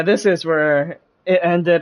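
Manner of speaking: ordinary talk, nothing marked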